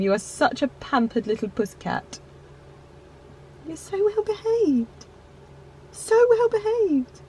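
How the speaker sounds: dote on the dog; high doting pet voice. Real, not acted. Praising her dog.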